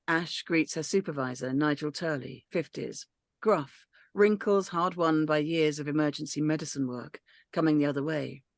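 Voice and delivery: normal talking voice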